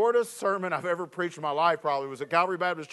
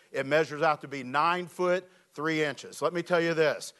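usual speaking voice

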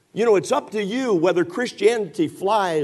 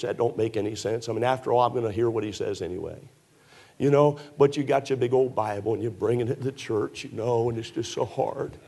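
sarcastic whine; 4 semitones lower than usual. Acted. Preacher mocking Bible-weary churchgoers, sarcastic sad. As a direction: pity them with heavy irony.

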